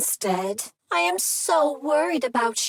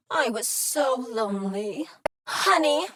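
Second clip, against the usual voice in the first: ordinary delivery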